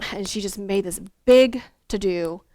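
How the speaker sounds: ordinary talk, nothing marked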